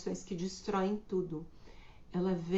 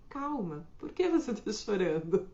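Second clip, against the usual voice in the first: pushy demand. Shown as a demonstration. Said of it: "é diferente de você dizer".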